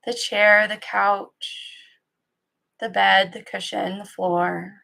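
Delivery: calm, even guiding voice with long pauses; guided meditation. Acted. Teacher settling listeners into practice, calm guided-meditation delivery. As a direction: ease listeners into stillness, leaving long silences between phrases.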